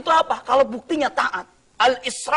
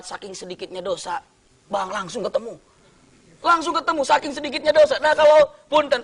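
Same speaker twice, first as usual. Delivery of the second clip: normal talking voice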